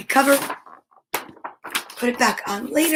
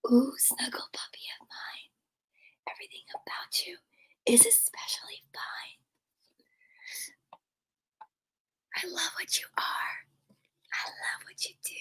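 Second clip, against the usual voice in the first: hushed, breath-only lullaby lines; barely-there whispered singing; 3 semitones higher, 15 dB quieter than usual. Real, not acted. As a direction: whisper the song very softly. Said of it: "It says to whisper it".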